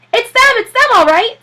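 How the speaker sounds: high, loud eager voice; high excited reporter; excited young man. Acted. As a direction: spot your target with glee.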